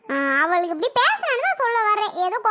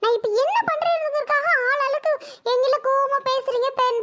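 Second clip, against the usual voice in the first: distressed protest; 4 semitones higher than usual. Acted. Accused woman protests to everyone.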